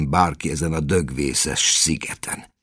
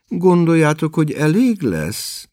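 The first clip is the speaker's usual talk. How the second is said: worried mother, pitched higher; anxious question; 10 semitones higher than usual. A narrator's reading. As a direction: ask fretfully, unsure it is enough. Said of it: "kérdezte aggályosan". (aggályosan, magasabb hangon)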